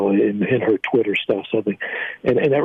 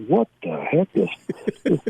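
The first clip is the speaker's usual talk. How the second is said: slow, high mock disbelief; imitated bewilderment, slowed right down; baffled double take; 5 semitones higher, much slower than usual. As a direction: retell their stunned double take, drawn out. Acting out listeners' double take.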